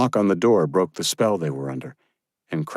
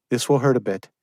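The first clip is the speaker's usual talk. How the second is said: higher, firm woman's voice; young woman's voice; 6 semitones higher, faster than usual. Acted. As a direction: give a brisk order. Josephine warning.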